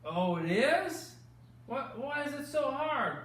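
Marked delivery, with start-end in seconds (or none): none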